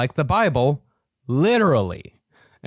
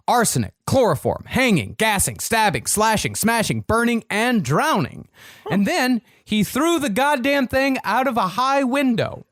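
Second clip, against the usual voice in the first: building intensity; 7 semitones higher than usual. Real, not acted. Listing cat deaths building.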